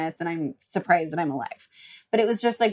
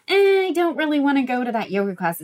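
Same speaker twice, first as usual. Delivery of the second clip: mock half-hearted excuse, pitched up; reluctant whine; 6 semitones higher, 4 dB louder than usual. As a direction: voice a mild, dragged-out reluctance.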